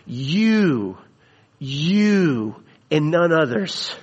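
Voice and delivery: You, you said apart, each You held and pressed; stress on You. Shown as a demonstration. Preacher voicing Jesus, hammering the word You. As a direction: lean on each You, then and none others. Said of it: "that you is an emphatic".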